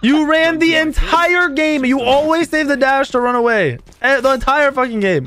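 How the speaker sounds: high, exasperated ribbing; indignant outburst. Real, not acted. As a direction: protest it high, stretching the words in disbelief. Heated teasing complaint at teammate.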